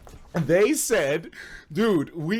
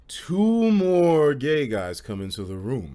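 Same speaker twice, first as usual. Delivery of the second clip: slow dramatic reveal; 4 semitones lower, slower than usual. Real, not acted.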